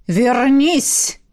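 resonant, drawn-out command; commanding summons. A narrator's reading. Necromancer calling a dead man back, commanding. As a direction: order the dead to obey.

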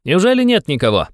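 put-on high, cheerful young man calling out; ringing cheerful cry. A narrator's reading. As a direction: call out gaily, pitched well up. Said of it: "Звонко и весело закричал подошедший". (звонкий весёлый возглас)

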